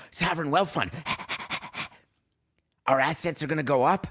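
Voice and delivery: giddy high excited commissioner, imitating; mock Gary Bettman. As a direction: ham up a gleeful league boss. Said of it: "Gary Bettman said".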